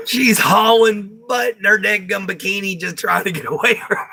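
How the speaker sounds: real laughter breaking into high-pitched words; laughing hard. Real, not acted. Telling viewers about the dad's chase, laughing hard. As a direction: crack up while getting the words out. Teller cracking up at own story.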